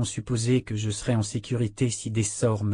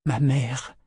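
moaning; 2 semitones higher than usual. A narrator's reading. Kalan grieving her mother, a mild moan. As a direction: let it slip out like a moan. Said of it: "gémit Kalan".

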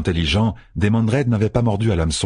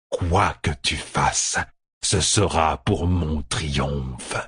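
distant dark villain; 2 semitones lower, much slower than usual. A narrator's reading. The dark one promises Rand its triumph, slowly. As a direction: let each word toll slowly, certain of victory.

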